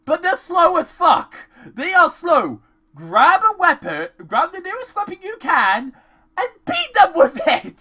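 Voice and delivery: high, loud, insistent rant; exasperated yelling. Acted. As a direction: climb into a high frustrated yell. Exasperated advice yelled at character.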